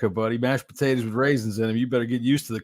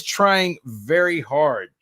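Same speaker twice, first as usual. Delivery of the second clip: slow, drawn-out "very hard"; emphatic stress on hard; 3 semitones higher, much slower than usual. Real, not acted. Host stressing the co-host's effort to make friends, emphatic "hard". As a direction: lean slowly and deliberately on "hard". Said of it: "I really wanna emphasize the word hard".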